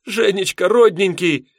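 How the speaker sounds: high, tearful cry of joy; happy crying. A narrator's reading. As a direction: call his name through happy tears. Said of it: "сквозь слёзы радости кричала жена".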